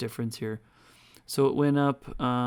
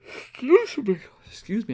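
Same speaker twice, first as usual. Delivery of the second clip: apology squeezed out through a real yawn, much higher; 11 semitones higher, 8 dB louder than usual. Real, not acted. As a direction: talk through a big yawn. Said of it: "I keep yawning".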